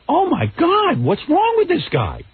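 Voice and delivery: high-pitched retold panic at the assistant; retold alarm. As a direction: squeal it out in disbelief. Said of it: "I said to Laura".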